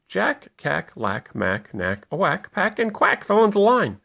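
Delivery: plain reading voice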